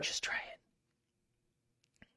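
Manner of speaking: slow breathy whisper "Just try it"; coaxing whisper. Real, not acted. Egging a friend to try the accent, whispered. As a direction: coax him in a hush.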